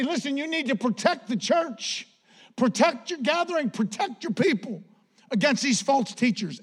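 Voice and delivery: high-pitched, impassioned retold warning; extreme urgency. Apostle warning against false teachers, urgently impassioned. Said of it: "He's going to the extreme to say".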